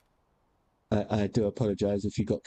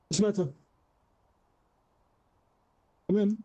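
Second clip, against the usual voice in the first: higher, sing-song coo to the cat; pet talk; 4 semitones higher than usual. Real, not acted. Owner coaxing his cat Apollo, in a high cooing pet voice. Baby-talking cat on camera.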